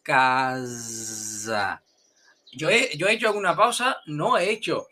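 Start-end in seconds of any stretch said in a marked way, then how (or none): 0.0-1.8 "casa" low and stretched long on one buzzing z; drawn-out stress. Shown as a demonstration. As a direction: overdo it, "caaazzza", in one long slide. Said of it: "van a poner un estrés que no lo hay". ("casa" alargada, con la z exagerada)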